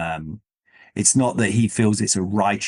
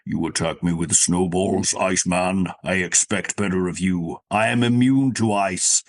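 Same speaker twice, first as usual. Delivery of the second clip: robot voice; 3 semitones lower than usual. Acted. Host imitating a villain taunting Iceman, robot voice. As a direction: intone it like a robotic supervillain. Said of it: "sort of that kind of robot voice".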